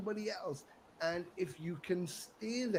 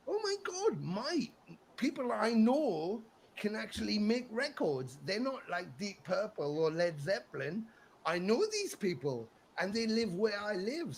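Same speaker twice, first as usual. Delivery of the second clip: high, louder burst of amazement; amazed; 4 semitones higher, 3 dB louder than usual. Retold or imitated. Young fan realizing his friends make records, excited. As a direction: gush as if it's unbelievable.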